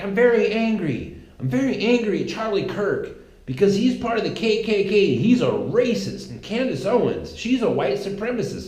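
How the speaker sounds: high, whiny mock outrage, pitched well up; mocking angry protester. Protester ranting to the interviewer, in high mock outrage. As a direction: lampoon the protester's hollow fury.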